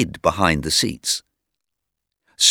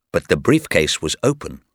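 ordinary delivery, nothing marked

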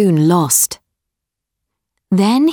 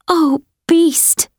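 put-on higher, pleading girl voice; begging the dying beast, pitched up; young girl voice; 9 semitones higher than usual. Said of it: "she said".

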